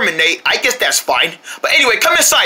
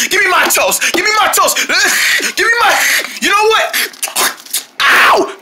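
frantic demand; 10 semitones higher than usual. Acted. Homeowner grabbing the toast from the mouse, high and shouting. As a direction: shout for the toast until bitten.

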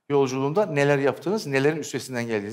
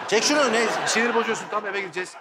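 grumpy woman; 5 semitones higher than usual. Acted. Grumpy woman role in skit.

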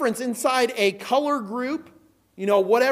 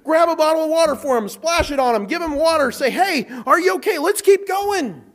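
louder, higher, urgent helping; eager helper; 5 semitones higher, 5 dB louder than usual. Retold or imitated. Preacher acting out helping a tired runner, louder and higher. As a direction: rush in to help the fading runner.

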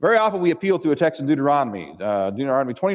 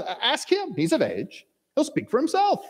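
pitched up and pleading, passing the buck; nervous evasive parents; 11 semitones higher than usual. Acted. Parents ducking the Pharisees' question, high and nervous. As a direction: squeak it out to get off the hook. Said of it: "that particular tone of voice with their words".

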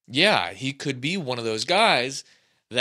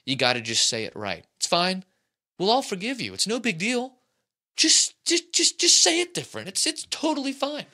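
a grandpa's gentle pleading that climbs much higher by the end; kindly scolding grandfather, rising higher and higher; imitated grandpa; 8 semitones higher, 4 dB louder than usual. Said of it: "He would say".

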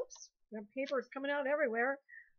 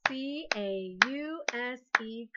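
slow, letter by letter; spelling aloud; slower than usual. Shown as a demonstration. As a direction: clap out each letter slowly. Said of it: "clap a syllable".

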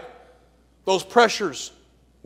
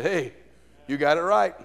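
gentle answer; 4 semitones lower, 7 dB quieter than usual. Acted. Jesus answers Peter softly.

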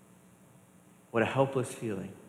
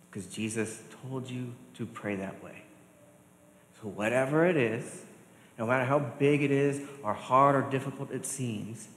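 ordinary talk

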